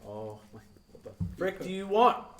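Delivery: groggy start rising into a loud, high annoyed snap; half asleep, then fed up with the knocking; annoyed outburst. Retold or imitated.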